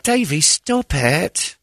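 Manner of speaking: put-on high, slow girlish voice pushing him off; imitating a prim British girl; mock British girl. To Davy, a mock girlish protest.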